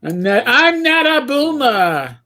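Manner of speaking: normal talking voice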